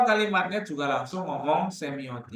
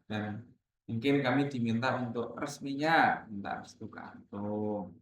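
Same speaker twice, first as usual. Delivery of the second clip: polite formal request; 6 semitones lower, 5 dB quieter than usual. Shown as a demonstration. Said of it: "Itu ngomongnya".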